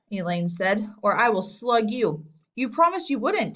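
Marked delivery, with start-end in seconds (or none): 2.6-3.5 high whiny complaint; whined. A narrator's reading. Little boy whines at the girl who threatened him, high and whiny. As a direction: whine it like a wronged little kid. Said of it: "Daniel whined".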